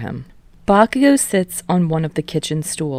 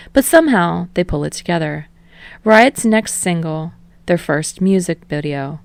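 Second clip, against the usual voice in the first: ordinary delivery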